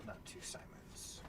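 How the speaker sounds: whispered aside to self; whispering. Real, not acted. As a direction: whisper it while counting. Muttering to self while counting cards.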